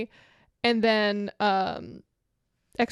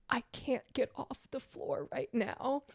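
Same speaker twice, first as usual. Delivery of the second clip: much softer, strained plea; weak in pain; 14 dB quieter than usual. Retold or imitated. Telling the producer she can't stand, faint. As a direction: barely get it out to the producer. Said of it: "I'm like".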